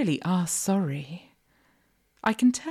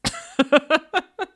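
high, loud burst of giggles; mocking laugh; 11 semitones higher, 6 dB louder than usual. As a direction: laugh loudly at their confusion. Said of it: "as I laugh".

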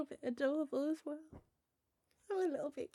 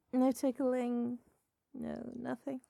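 normal talking voice

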